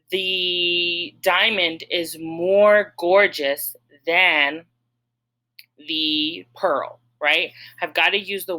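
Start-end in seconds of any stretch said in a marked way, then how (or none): 0.1-7.0 very slow, even example sentence; slow model sentence. Shown as a demonstration. Teacher modelling a sentence for students, very slow. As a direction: lay out the model sentence word by word. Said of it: "So I would say".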